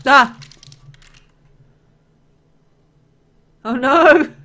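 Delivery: loud startled shriek, high; frightened scream. Real, not acted. Monster jumps out.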